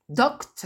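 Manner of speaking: "Docte" said short, one syllable, final schwa dropped; English pronunciation. Shown as a demonstration. Teacher reading the word list to French students, modelling the reduced ending. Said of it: "vous voyez qu'on ne dit pas docteur".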